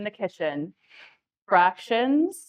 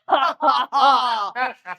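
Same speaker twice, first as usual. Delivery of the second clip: mock laugh; much slower than usual. Countess gloating while cooking.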